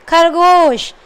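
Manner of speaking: ordinary delivery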